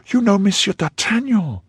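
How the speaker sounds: high, startled cry of recognition; surprised cry. A narrator's reading. Novice startled to hear d'Artagnan's name, crying out high. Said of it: "cried the novice".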